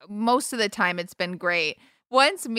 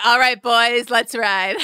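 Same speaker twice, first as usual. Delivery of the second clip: mock macho cowboy; 3 semitones higher, 6 dB louder, slower than usual. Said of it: "You're like".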